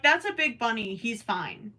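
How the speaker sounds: usual speaking voice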